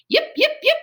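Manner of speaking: very high, yappy little yips; small dogs yipping in agreement. Acted. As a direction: yip like excited puppies. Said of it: "agreed his friends".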